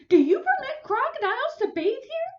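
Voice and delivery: high, loud indignant demand; indignant question. Acted. Neighbor demanding the lifeguard ban crocodiles, high and loud. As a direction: demand an answer, outraged. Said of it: "she cried".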